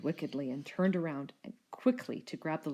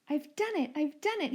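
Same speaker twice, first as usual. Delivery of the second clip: much higher gleeful crowing; triumphant cry; 9 semitones higher, 3 dB louder than usual. A narrator's reading. Rex crying out in triumph after the smash, high and gleeful. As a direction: cheer as if you've won. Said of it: "he cried".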